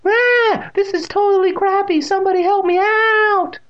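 very high, loud screeching cat voice; squealing panic, last words drawn out; panicked cat shriek. A narrator's reading. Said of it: "shrieked with amazingly human-sounding cries".

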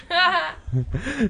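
high breathy giggle bursting out; real laugh. Real, not acted. Reader laughing at her Maury Povich joke, real giggle. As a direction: laugh at your own joke.

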